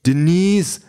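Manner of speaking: high, falling anxious call; simulated boyfriend crying out in concern; alarmed digital boyfriend. A narrator's reading. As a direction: call out her name, pitched high. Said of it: "sagt die Figur auf dem Monitor".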